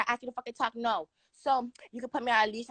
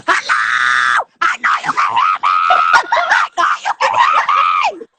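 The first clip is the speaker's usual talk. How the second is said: shouting; 11 semitones lower, 14 dB louder, much slower than usual. Real, not acted. Yelling at a guest who won't answer, a loud shout. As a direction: scream at him to answer.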